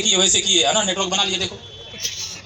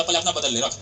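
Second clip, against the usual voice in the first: lower, softer dismissal; mock loving brush-off; 8 semitones lower, 7 dB quieter than usual. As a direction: brother waving him off low and soft. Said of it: "बड़े प्यार से बोला".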